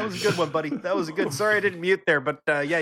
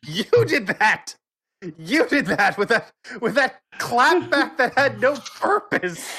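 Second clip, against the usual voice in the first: laughing accusation; 8 semitones higher than usual. Real, not acted. Blaming Kevin for the clapback, laughing hard. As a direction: keep laughing as you blame him.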